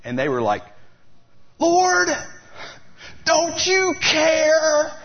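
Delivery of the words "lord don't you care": high, loud desperate wail; panicked cry. Retold or imitated. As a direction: wail it out, terrified of drowning. Panicked disciples cry to Jesus.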